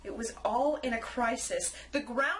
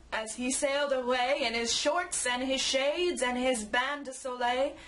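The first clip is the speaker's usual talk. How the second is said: plain reading voice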